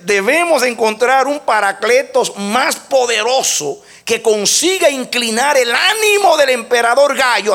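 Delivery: ringing pulpit reading; emphatic quotation. Retold or imitated. Quote reread as emphatic preaching.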